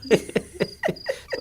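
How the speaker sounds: a voiceless, very high giggle; mocking breathy giggle; breathless high laugh. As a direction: burst out in a breathy high titter. Mocking mother's old beliefs.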